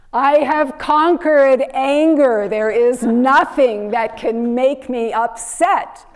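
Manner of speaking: thundering declaration of mastery; fear-inspiring roar. A narrator's reading. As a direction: roar it out so the villagers tremble. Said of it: "roars out in this fear, fearful, fear-inspiring voice".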